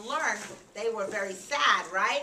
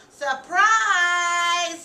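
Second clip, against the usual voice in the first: high, loud, drawn-out squeal; excited squeal; 8 semitones higher, 10 dB louder than usual. A narrator's reading. Rachel greets her parents at home, excited squeal. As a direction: squeal it out and hold the word. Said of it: "squealed Rachel".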